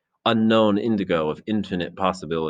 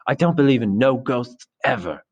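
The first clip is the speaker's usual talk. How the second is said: higher, emphatic indignation; indignant; 6 semitones higher, 3 dB louder than usual. A narrator's reading. Piggy holding the conch, dismissing ghosts, indignant. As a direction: insist crossly, pitch lifted. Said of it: "His voice was indignant".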